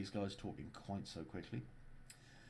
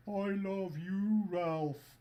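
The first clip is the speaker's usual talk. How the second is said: much higher, louder, very slow Scandinavian voice; high Scandinavian accent; 10 semitones higher, 5 dB louder, much slower than usual. Pretend. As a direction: gush it high in a Nordic lilt. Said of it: "that's why I'm doing the Scandinavian accent".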